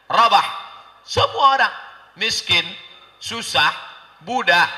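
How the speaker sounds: high, loud, punched-out phrases with pauses; fiery preaching. Real, not acted. Preacher listing how all looked down on Bilal, fiery. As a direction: hammer each point at the crowd.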